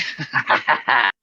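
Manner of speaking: self-amused chuckle; laughing at own joke. Retold or imitated. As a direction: imitate giggling at your own writing. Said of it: "the shameful part is I laugh".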